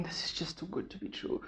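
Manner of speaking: breathy whisper, barely voiced, as if to herself; inner-thought whisper. Whispering her fear to herself after losing weight. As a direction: whisper the doubt like a private thought. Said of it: "I'm just thinking".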